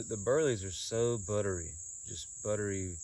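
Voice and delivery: ordinary delivery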